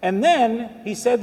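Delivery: usual speaking voice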